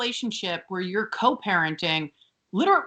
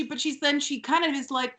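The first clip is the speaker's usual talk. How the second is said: usual speaking voice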